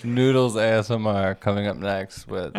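loud hype announcement; announcer voice. Pretend. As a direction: announce the next act like a host. Mock announcing a noodle ASMR show.

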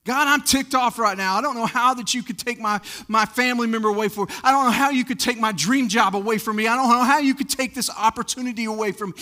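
mildly heated, hurried complaint to God; mad at God. Acted. Preacher voicing a believer's complaint to God, mildly angry. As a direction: air frustration in a rush, then spell it out. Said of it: "So if you're mad, tell him you're mad".

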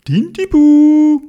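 long, high, sustained name calls; searching call. A narrator's reading. Searchers calling everywhere for the lost little squid, long drawn-out shouts. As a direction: call the name far out, holding the last vowel. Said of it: "gesucht und gerufen".

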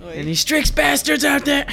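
high taunting shout into the dark; calling out. Pretend. Hunting enemies, calling out.

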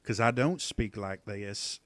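slow Southern drawl; stretched-out Southern US accent; deep Southern accent. Shown as a demonstration. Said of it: "like, a deep Southern accent".

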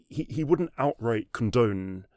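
normal talking voice

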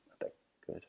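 muttered unvoiced aside on not that good; not that good, breathed without voice; whispered repeat. Real, not acted. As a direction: whisper it to yourself. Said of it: "sound quality".

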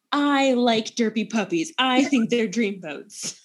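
ordinary talk

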